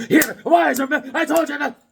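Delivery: high mock-mother scolding; mild mock mom scold. Mother nagging her warmed-up son, high scold. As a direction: nag like a mom. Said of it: "And then".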